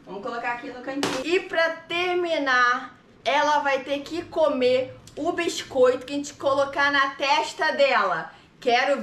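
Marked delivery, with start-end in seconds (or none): none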